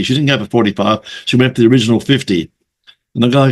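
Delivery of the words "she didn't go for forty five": normal talking voice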